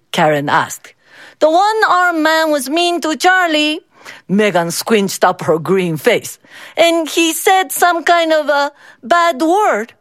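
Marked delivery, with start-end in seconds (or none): 1.4-3.8 put-on high child voice telling on someone; girl telling her mother what happened; tattling little girl. A narrator's reading. 6.8-9.9 put-on high child voice telling on someone; girl telling her mother what happened; tattling little girl. A narrator's reading.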